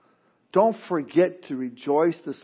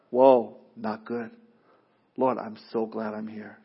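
slow dismay; 7 semitones lower, 5 dB quieter, slower than usual. Acted. Looking down at earth from heaven.

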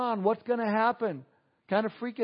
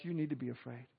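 much softer, lower ominous warning; grave warning; 6 semitones lower, 13 dB quieter, faster than usual. Acted. Preacher warns unbelievers, hushed and ominous. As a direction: drop low and let "afraid" land quietly. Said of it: "I need to be honest with you".

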